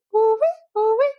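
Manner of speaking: toned-down imitation of a village alarm cry; softened alarm call. Shown as a demonstration. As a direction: demo the village alarm cry without full volume. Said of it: "doing this".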